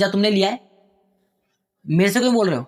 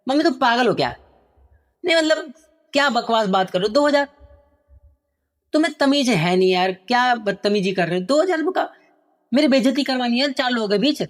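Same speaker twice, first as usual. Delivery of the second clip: angry son; 4 semitones higher than usual. Acted. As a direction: snap at her like a spoiled son. Angry son shouting at mother. (गुस्से में चिल्लाता बेटा)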